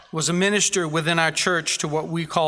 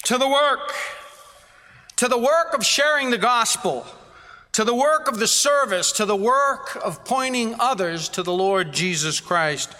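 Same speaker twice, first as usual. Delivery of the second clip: preaching at full force; 6 semitones higher than usual. Acted. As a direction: hammer each "to the work" home. Preacher's rising call to action.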